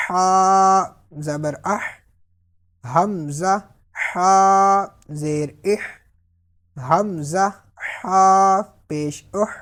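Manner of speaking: اہ sounded with a clear breathed ہا at the end; slow, loud drill of the h; breathy h sound. Shown as a demonstration. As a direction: push air out on the final ہا of اہ. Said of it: "آپ نے اس پہ try کرنی ہے".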